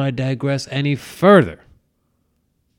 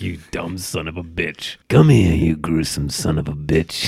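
lightly voiced low, grumbling fan; low mock insult, building on the second; mock irate Trekkie; 10 semitones lower than usual. Host imagining annoyed Trekkie listeners, low mock grumbling. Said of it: "Trekkies listening to this gonna be like".

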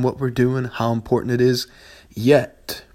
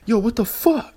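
mock gamer outrage; 11 semitones higher than usual. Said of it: "I'm like".

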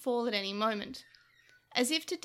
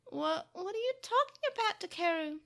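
high, stammering bewilderment; surprised; 6 semitones higher than usual. A narrator's reading. Tokomon hears Takeru disown his brother, surprised. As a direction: ask in startled disbelief. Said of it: "Tokomon asked in surprise".

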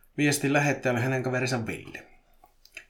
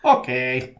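vastaus; 7 dB louder than usual. A narrator's reading. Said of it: "Petri vastasi".

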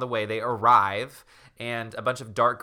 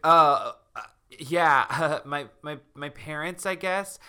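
put-on higher-pitched flustered girl, haltingly; mock awkward stammer; 4 semitones higher, 5 dB louder, slower than usual. Sarah answers her bunkmates, mock awkward stammering. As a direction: burst out, then falter sheepishly.

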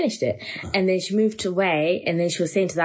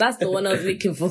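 ordinary talk

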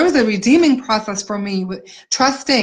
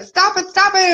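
mock scolding; 9 semitones higher than usual. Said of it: "And I would be like".

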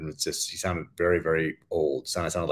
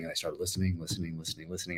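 the repeats fade softer and softer into near silence; fading away; 10 dB quieter, faster than usual. Shown as a demonstration. Author remembering endless sample listening, fading softer. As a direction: let each repeat drift away, as if the search drags on for hours.

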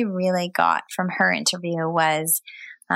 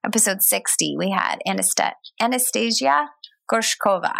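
ordinary talk, nothing marked

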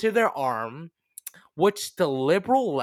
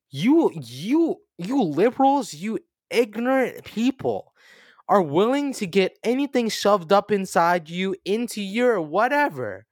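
angry rant; 6 semitones higher, 7 dB louder than usual. Acted. Mock right-wing rant at liberals.

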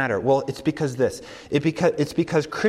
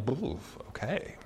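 a soft nervous ooh okay; timid student; 13 dB quieter than usual. Retold or imitated. Student invited into professor's office, nervous and quiet. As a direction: shrink a little at the invitation. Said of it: "And you're like".

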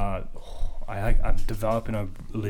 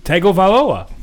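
triumphant; 9 semitones higher, 9 dB louder than usual. Real, not acted. Finally landing the name.

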